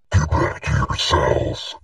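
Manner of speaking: low, slow monster voice; deep, drawn-out creature command; monster guard yelling. Acted. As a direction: bellow the order slowly from deep down. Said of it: "He yells out to you guys".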